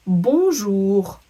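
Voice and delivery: bonjour said slow and loud, the n silent; French pronunciation demo. Shown as a demonstration. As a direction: model "bonjour" for learners, drawn out, nasal first vowel. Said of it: "just repeat after me".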